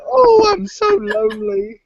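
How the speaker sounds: very high, slow hammed-up sob; fake-wail it; mock crying.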